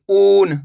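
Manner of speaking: "ऊन" with a long, slow oo; drawn-out vowel. Shown as a demonstration. Teacher giving an example of big u, drawn-out vowel. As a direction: stretch the opening sound of "ऊन". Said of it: "इसको खींच के पढ़ेंगे".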